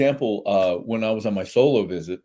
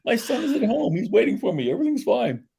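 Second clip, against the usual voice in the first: high, laughing, almost crying; laughing I'm okay. Retold or imitated. Solo diner assuring worried family, laughing high. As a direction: insist you're fine through laughter. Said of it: "So I'm like".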